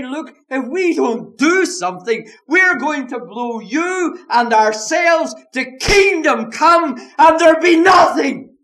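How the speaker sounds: loud, high, desperate shout; preacher voicing the learned men at full shout; shouted warning. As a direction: cry it out as if the world will end. Voicing educated men's warning.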